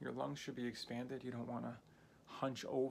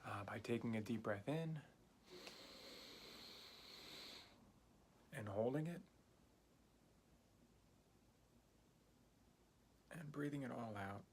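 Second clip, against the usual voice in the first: soft, unhurried breathing guide; guided meditation; 6 dB quieter than usual. Acted. Teacher guiding a beginner's breathing exercise, soft calm meditation voice.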